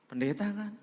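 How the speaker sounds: eager question. Retold or imitated. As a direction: sound hopeful about a discount. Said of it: "kalau pendeta ada diskon spesial". (pertanyaan penuh harap, nada tinggi)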